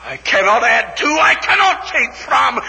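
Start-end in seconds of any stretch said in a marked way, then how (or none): none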